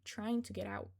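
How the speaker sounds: ordinary delivery, nothing marked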